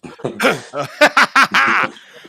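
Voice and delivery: loud, high burst of laughter; hearty laugh. Real, not acted. Host amused by his own banter, hearty laugh.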